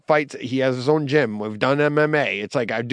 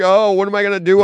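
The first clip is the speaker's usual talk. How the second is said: higher, louder mock despairing cry; 6 semitones higher, 5 dB louder, faster than usual. Dreading his daughter's wedding: mock despairing cry. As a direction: wail it like you're falling apart. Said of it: "being like".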